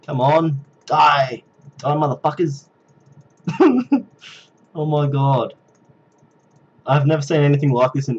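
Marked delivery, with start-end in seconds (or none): 3.5-4.0 short high-pitched laugh. Real, not acted. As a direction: burst out laughing. After yelling at zombies.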